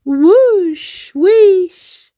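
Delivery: big breathy high whoosh like a wave; whooshing wave sound. Acted. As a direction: swell it up like a crashing wave. Wave sound effect in story.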